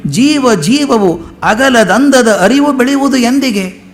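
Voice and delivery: slow, lilting recital of the verse; poem recitation. Shown as a demonstration. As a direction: savour each word like a song.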